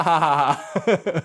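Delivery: ordinary talk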